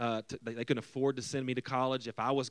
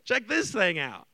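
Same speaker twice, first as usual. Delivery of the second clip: showing off; 7 semitones higher, 8 dB louder, slower than usual. Retold or imitated. Voices friend showing off item.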